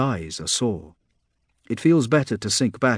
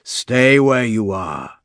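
monster command; 6 dB louder, much slower than usual. A narrator's reading. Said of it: "The thing comes closer".

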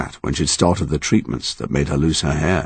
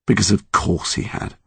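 ordinary delivery

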